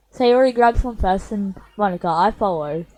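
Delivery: normal talking voice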